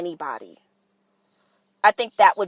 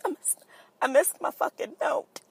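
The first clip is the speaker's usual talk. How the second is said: mock anguish; 6 semitones higher, 6 dB quieter than usual. Mock anguish over missed note.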